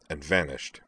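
ordinary delivery

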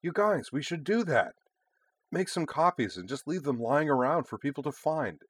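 high, eager schoolgirl idea; bubbly teen, delighted; excited girl voice. Acted. Yui voiced excitedly to bandmates.

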